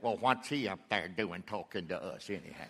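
low, soft mimicked grumble; grumbling congregant. Pastor voicing a doubter in the pews, low grumble. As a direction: imitate a critic murmuring under their breath.

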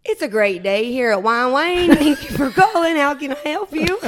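loud, high country-accented gush; over-excited phone greeting. Shown as a demonstration. Receptionist answering the salon phone, over-excited and loud. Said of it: "And this is what she says".

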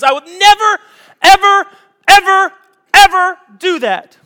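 high, loud, insistent protest; Peter's vow. Acted. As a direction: swear it loudly, every ever pushed. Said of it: "and Peter goes".

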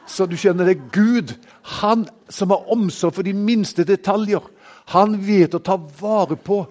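ringing sermon delivery; full-force preaching. Acted. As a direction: proclaim it to the whole congregation. Preaching on God's care.

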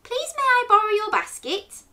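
high, sweetly polite request; very nice asking. A narrator's reading. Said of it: "he asked very nicely".

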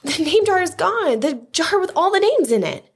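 narrator's contained shout, pitch way up; shouted alarm. A narrator's reading. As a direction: report the loss in alarm. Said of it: "Ralph shouted at him".

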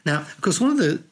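ordinary delivery

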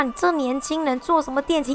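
high mock scolding; imitating a furious auntie's scolding; retold yelling. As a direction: rail at the young person like the angry auntie. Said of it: "就狂骂我".

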